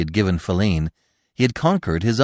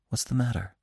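soft, higher, anxious question; hushed concern, slightly raised pitch; solicitous whisper; 4 semitones higher, 6 dB quieter, faster than usual. A narrator's reading. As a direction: ask gently, almost whispering. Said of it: "he whispered solicitously, while his voice quavered".

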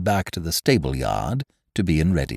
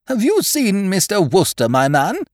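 higher, imperious schoolmistress; headmistress; 5 dB louder than usual. Acted. Headmistress to Jeeves in the yard, imperious. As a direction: demand an answer from the chauffeur.

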